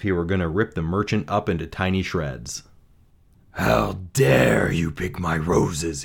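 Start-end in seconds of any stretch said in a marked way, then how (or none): 3.5-6.0 slow, growling monster snarl; slow, menacing roar of accusation; snarling beast. A narrator's reading. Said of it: "he snarled".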